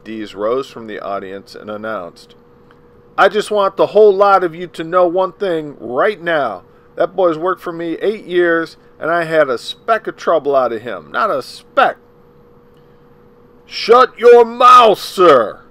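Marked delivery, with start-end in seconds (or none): 3.2-12.0 higher and much louder, a man standing up in court to be heard; a protesting shout from the gallery; loud outburst. Acted. Link Dees rises from audience announcing. 13.7-15.6 very high, very loud slow roar; a judge roaring at a man from the audience, furious roar. Acted. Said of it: "was wide awake and roaring".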